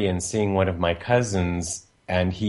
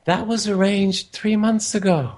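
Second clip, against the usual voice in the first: quoted thought, much higher, incredulous; inner thought; 11 semitones higher than usual. Retold or imitated. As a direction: voice the thought up high in disbelief. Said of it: "And I thought".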